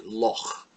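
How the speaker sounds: "loch" with a guttural final fricative; throaty ch. Shown as a demonstration. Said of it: "pronounced way down in the bottom of the throat".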